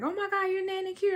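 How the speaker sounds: high, fast starstruck squeal; quick, high-pitched gushing recognition; excited fan. Retold or imitated. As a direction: imitate a fan spotting someone famous. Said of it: "people just walking up talking to me".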